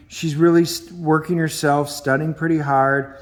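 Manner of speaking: usual speaking voice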